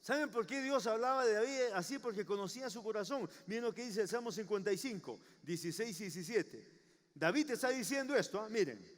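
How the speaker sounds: ordinary talk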